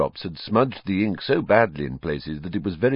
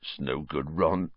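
low, soft resignation; sad; 4 semitones lower, 8 dB quieter than usual. Acted. Hagrid gives up hope to Ron, low and sad. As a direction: give up quietly, pitched down. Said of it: "said Hagrid sadly".

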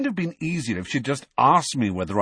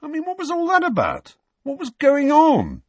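indignant, incredulous questioning; exasperated bafflement; 5 dB louder than usual. Acted. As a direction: demand an explanation, utterly baffled. Harry baffled after date disaster.

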